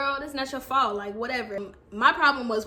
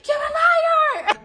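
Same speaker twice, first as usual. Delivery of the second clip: high, loud mock shriek; hammed-up shrieking outburst; screamed accusation; 8 dB louder, slower than usual. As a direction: scream it at the cheating boyfriend. Acted accusation at boyfriend.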